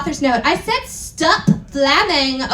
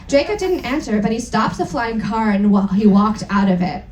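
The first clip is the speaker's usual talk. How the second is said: ordinary talk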